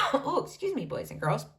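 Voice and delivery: ordinary delivery, nothing marked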